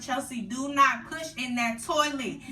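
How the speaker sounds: slow, loud firm warning; a hint of a strict mom; mock stern mother. Mother warning her daughter on the phone, firm. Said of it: "she's like".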